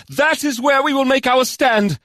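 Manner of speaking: high-pitched, determined leader; resolute commander. Acted. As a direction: declare it high and firmly to troops. Commander declares the plan.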